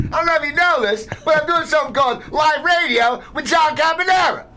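high exasperated shout. Real, not acted. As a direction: yell it over the room. Yelling at interrupter during live show.

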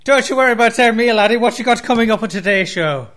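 hammed-up Irish banter; Irish accent. Cohost in a put-on Irish voice dodging the host's question. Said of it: "affecting a horrible accent".

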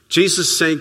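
normal talking voice

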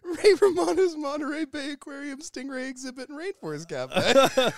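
high, loud and cracking up; laughing. Real, not acted. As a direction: blurt it out mid-laugh. Repeated through laughter.

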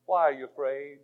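usual speaking voice

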